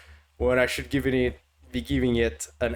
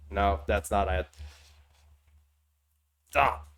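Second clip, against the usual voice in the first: ordinary talk, nothing marked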